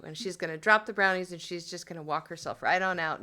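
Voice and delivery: ordinary talk, nothing marked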